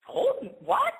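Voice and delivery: high, startled mock question; shocked what. The girl reacting to the news, mock shocked. Girl's shocked retold reply.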